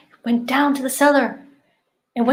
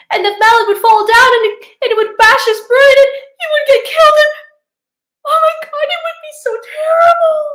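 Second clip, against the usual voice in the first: higher and louder, faster panicked wail; rising panic; 12 semitones higher, 9 dB louder, faster than usual. Acted. Daughter imagining her son's death, panicking higher and louder. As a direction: spiral into hysterical panic.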